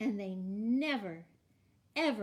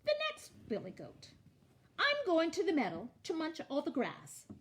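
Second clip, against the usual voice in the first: high, quick billy goat voice; bleating goat; 8 semitones higher, 3 dB louder, faster than usual. A narrator's reading. Middle goat telling the troll where he's headed, high bleat. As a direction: bleat it out lightly and briskly.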